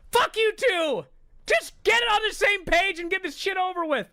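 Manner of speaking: very high, loud re-enacted shouting; exasperated yell. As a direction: yell at them to hurry up, furious. Re-enacts yelling at the wrestlers.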